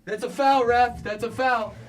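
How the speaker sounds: high-pitched, loud mock foul appeal; James Harden impression. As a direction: yelp at the ref in a hammed-up, high player voice. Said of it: "uh, James Harden impression".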